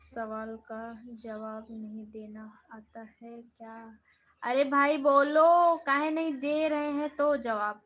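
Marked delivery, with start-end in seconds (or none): 4.4-7.8 calling out loudly to the silent viewer; exasperated call. Real, not acted. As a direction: shout at the chatter to answer. Calling out to demand an answer.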